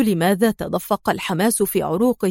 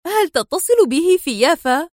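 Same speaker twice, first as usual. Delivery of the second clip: high, edgy question; nervous; 7 semitones higher than usual. A narrator's reading. Rachel questioning Adham during his call, high and nervous. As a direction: ask urgently with nerves showing. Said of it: "همست في عصبية".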